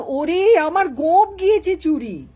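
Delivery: high, loud comic wail; hollered alarm. Acted. Boss babu announcing his stolen moustache, high alarmed holler. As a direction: cry out the dreadful loss. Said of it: "Babu হাঁকেন".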